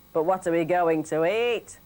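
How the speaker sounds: a hint of grumpy Billy; grumbling husband.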